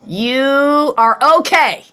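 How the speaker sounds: slow, loud, high mock announcement of U K; pun punchline. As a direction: land the U K pun with a flourish. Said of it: "a joke about the U K".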